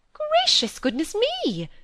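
very high, drawn-out gasp of words; scandalised, credulous spinster; astonished exclamation. A narrator's reading. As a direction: exclaim as if hearing shocking gossip. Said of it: "exclaimed Miss Phoebe".

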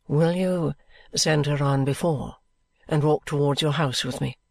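a proud lady softening her tone with care; Lady Dedlock speaking gently to Miss Summerson; Lady Dedlock, kind but composed. A narrator's reading.

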